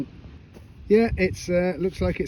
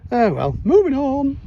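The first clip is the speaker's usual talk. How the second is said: sing-song catchphrase; 8 semitones higher, 4 dB louder than usual. Acted. Shrugging off a dud find to viewers, sing-song. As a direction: lilt it up like a jingle. Catchphrase when moving to next dig.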